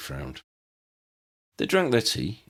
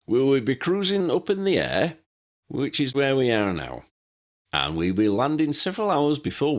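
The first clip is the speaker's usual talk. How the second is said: lightly voiced old captain rambling over the intercom; old pilot's announcement; 2 semitones higher than usual. Pilot announcing to his passengers, cheery old captain voice.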